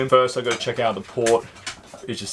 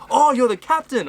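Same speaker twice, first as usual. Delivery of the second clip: high, louder sudden realisation; imitating the man's surprise, pitched up; mock surprise; 12 semitones higher, 5 dB louder than usual. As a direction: play his surprise as he finally gets it. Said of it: "He goes".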